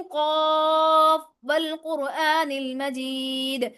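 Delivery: slow melodic recitation of قاف والقرآن المجید; Quranic recitation. Shown as a demonstration. Teacher recites the verse for students, slow melodic recitation. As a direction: chant it slowly as in tajweed class. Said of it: "اب میں پڑھ رہی ہوں".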